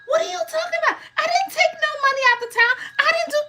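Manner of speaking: high, shouting protest; mother's indignant denial. Retold or imitated. Mother denies taking townhouse money, high indignant shout. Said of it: "when I call my mother".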